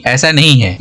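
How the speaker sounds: usual speaking voice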